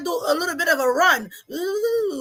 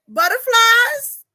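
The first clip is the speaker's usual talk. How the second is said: excited question; 6 semitones higher, 6 dB louder, slower than usual. Real, not acted. Playful question to child.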